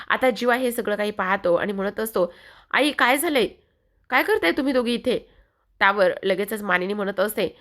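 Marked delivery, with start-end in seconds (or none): 2.7-5.3 alarmed question. Retold or imitated. Jiva surprised finding women at door.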